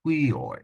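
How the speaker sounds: "Hui, oi" with a breathed H, pitched high and falling; rough breathing. Shown as a demonstration. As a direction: push an H into the front of "Hui, oi". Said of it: "with the rough breathing mark".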